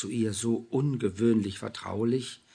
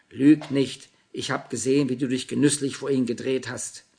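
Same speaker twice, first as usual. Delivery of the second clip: louder, sharp accusation; cutting in; 3 semitones higher, 9 dB louder than usual. A narrator's reading. Julia cutting Anna off, loud accusation. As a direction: jump in and accuse. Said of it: "Julia kam ihr zuvor".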